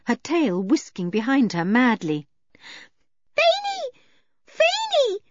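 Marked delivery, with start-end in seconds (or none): 3.4-5.2 put-on very high girl's call; high-pitched child's cry. A narrator's reading. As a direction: call out after a runaway pet. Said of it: "cried Eily".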